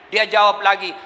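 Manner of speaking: normal talking voice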